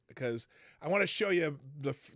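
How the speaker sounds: ordinary talk, nothing marked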